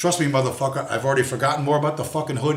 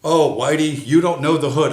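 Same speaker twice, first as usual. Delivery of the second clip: louder mock taunt; louder sneering imitation; 3 semitones higher, 7 dB louder than usual. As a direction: parody their condescension. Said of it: "when brown people tell me".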